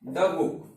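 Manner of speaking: the said as a short द; the before a consonant. Shown as a demonstration. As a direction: example read softly with the as द. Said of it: "जैसे".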